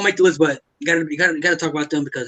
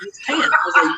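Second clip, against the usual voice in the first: ordinary delivery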